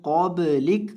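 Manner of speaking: ordinary talk, nothing marked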